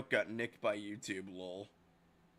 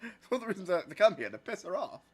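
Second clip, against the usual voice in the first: laughing; 7 semitones higher than usual. Real, not acted. Laughing at own joke.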